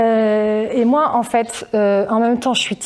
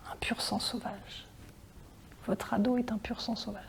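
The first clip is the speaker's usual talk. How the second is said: very soft, as if breaking delicate news; acted hushed disclosure; hushed confiding; 2 semitones lower, 19 dB quieter than usual. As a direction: drop to a near-whisper. Delicate news broken to parents.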